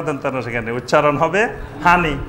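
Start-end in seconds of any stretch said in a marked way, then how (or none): 1.8-2.1 Noakhali accent. Shown as a demonstration. Preacher mimics how Noakhali folk say হানি for পানি. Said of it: "নোয়াখালীর লোককে জিজ্ঞাসা করেন".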